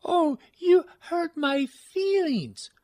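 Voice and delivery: high, slow mock-wounded whine; mock hurt whine. Mocking a co-host with a high hurt whine. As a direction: whimper it like a wounded child, hammed up. Mock whiny reply to insult.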